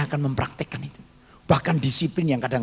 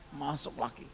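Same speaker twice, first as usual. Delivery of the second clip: ordinary delivery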